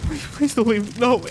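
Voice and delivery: high, cracking pleas; begging. Acted. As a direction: beg him not to leave you. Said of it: "He's like".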